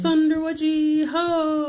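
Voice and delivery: loud, high, drawn-out rallying call; mock battle cry. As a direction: holler it long and high like a charge. Jokey battle cry over the word.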